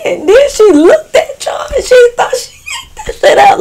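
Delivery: squealing, very high laughing retelling; helpless laughter. Retold or imitated. Retelling how she couldn't stop, helpless laughter. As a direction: laugh so hard the words squeak out. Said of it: "but I couldn't help it".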